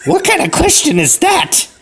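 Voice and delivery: very high falsetto granny, affronted; hammed-up outraged old lady. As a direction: put on an indignant high old woman. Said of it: "And she's like".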